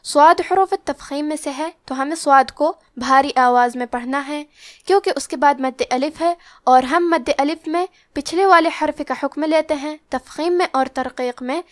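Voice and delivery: usual speaking voice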